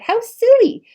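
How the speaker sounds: high, loud burst of disbelief; excited exclamation. A narrator's reading. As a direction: toss it off in a high, incredulous cry. Narrator exclaims.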